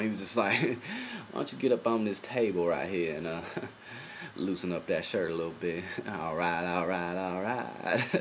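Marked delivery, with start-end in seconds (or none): 1.3-8.1 Matthew McConaughey impression. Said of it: "let me put my Matthew McConaughey back on".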